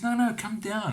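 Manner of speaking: high-pitched, urgent retold order; insistent call. Brother insists he come down.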